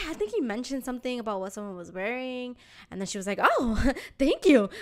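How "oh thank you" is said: higher, louder delighted thanks with a laugh; gushing mock thank-you; happy, flattered. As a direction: imitate someone thrilled by a compliment. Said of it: "getting all happy".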